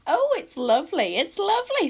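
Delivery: high, loud, excitable squeak; squeaky little creature. A narrator's reading. Tiny follower marvelling at a lovely clearing, squeaky and loud. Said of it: "squeaked Squeeze Juice".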